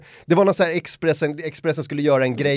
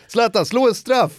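put-on kid calling out; 9 semitones higher, 5 dB louder than usual.

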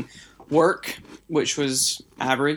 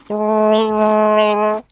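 loud drawn-out; 8 semitones higher, 7 dB louder than usual. Pretend.

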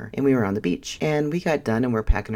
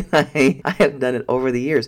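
laughing; 4 semitones higher, 6 dB louder than usual. Real, not acted. Laughing while telling her habit.

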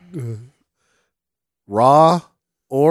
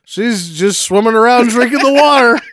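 dad voice; 9 semitones higher, 6 dB louder than usual. Retold or imitated. Dad answers about mom.

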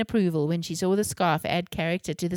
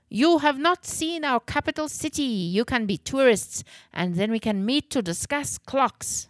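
higher-pitched, eager character voice; excited Herr Gutmann; 6 semitones higher than usual. A narrator's reading. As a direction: invite them along in a raised, put-on voice.

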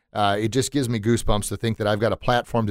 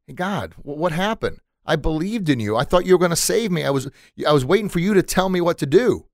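high-pitched, hurt protest; acted plea of a let-down believer; bewildered complaint; 7 semitones higher than usual. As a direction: question God, pitched up and aggrieved. Drowned man complains to God.

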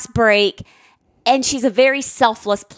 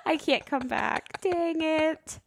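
much softer, feigned disappointment; mock regret; 3 semitones higher, 12 dB quieter, slower than usual. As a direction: grumble it softly as a joke. Mock regret at being banned.

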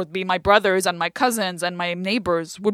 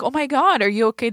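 concerned; 5 semitones higher, 5 dB louder, faster than usual. Retold or imitated. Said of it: "instead of being like".

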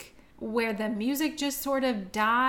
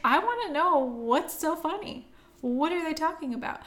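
higher-pitched retold plea to be told; exasperated curiosity; 7 semitones higher than usual. Viewer shut out of the characters' laughing, exasperated curiosity. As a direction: demand to be let in, acting out the frustration. Voicing own frustrated reaction to scene.

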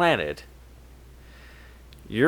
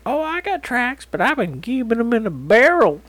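mock Matthew voice; 9 semitones higher than usual. Mocking Matthew's claim.

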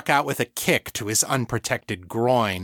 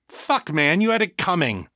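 sharp, higher snapping retort; snapped; 7 semitones higher than usual. A narrator's reading. Egan snapping at the injured Victor, higher angry retort. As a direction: snap it back at him, higher. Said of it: "Egan snapped".

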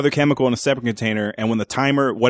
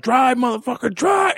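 rising, much higher yell; mock yell; 10 semitones higher than usual. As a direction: push the cabbie frantically. Urging the cab driver.

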